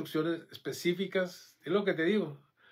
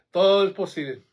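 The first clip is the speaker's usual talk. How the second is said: loud proclamation; 3 semitones higher, 10 dB louder than usual. Acted. Preacher affirms after congregation repeat.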